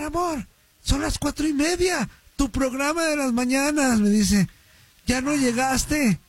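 alarmed wife. Retold or imitated. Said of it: "pero asustadísima".

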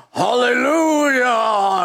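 slow, ringing churchy shout; hammed-up Sunday praise; drawn-out praise cry; mock hallelujah shout.

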